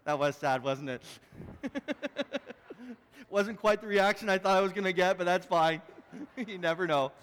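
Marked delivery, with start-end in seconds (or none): 1.3-2.9 awkward laugh. Real, not acted. Awkward laugh after no reaction.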